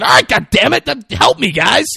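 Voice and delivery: high, loud cry for help; exasperated yell. Retold or imitated. Martell yelling to teammates as his car is shot, exasperated. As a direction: holler hammed-up panic and frustration.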